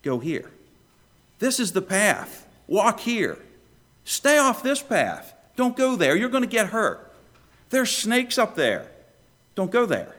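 high, emphatic warning commands; directing shepherd. Acted. Shepherd warning his followers off a dangerous path, urgently directing. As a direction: call out slow, firm directions to the flock.

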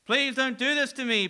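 high desperate plea; a man begging not to be condemned, pleading. Acted. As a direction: beg for mercy, voice lifted. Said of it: "pleads the man".

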